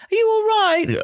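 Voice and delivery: put-on very high, loud babying question. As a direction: coo loudly at a teenager like a baby. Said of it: "suddenly going".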